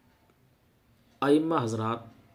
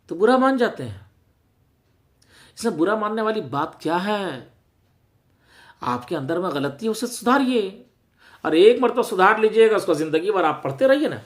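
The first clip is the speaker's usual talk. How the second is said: raised voice, loud and insistent; exasperated exhortation; 7 semitones higher, 9 dB louder than usual. Real, not acted. Preacher urges offended imams to fix their mistakes, loud exasperated exhortation. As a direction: challenge them forcefully, pitch climbing. Preacher challenges those who resent correction.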